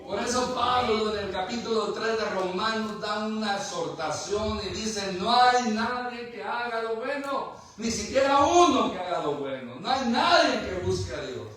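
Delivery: loud, high-pitched sermon delivery; preaching at full force. Real, not acted. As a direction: drive the scripture home, loud and sustained. (predicando con fuerza, voz alta y aguda)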